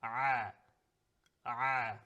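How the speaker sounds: Arabic ayn, throaty Ah; Arabic ayn sound. Shown as a demonstration. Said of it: "It sounds like this".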